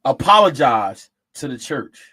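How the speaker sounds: mock order, punched loudly; retold stern order. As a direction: push out the command, imitating the pastor. Retells pastor ordering a young woman.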